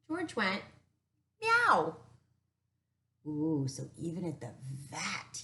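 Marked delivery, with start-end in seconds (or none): 1.4-2.0 high, loud meow like a cat. Acted. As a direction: belt out a cartoon cat meow. George meows at the vet.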